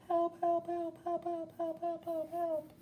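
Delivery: tiny squeaky high voice crying help; little friend's cries for help. A narrator's reading. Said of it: "He's saying".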